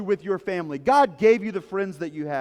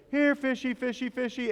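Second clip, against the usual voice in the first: high sing-song coaxing call; lilting high call to the fish; Ernie calling fish; 6 semitones higher, 3 dB quieter than usual. Retold or imitated. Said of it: "And Ernie just goes".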